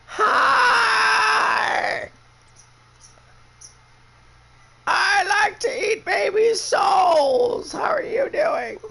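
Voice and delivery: very high, loud shrieking witch voice; shrieking mother-in-law. Retold or imitated. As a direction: screech it like a wicked witch greeting you. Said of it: "That's what I hear when she talks".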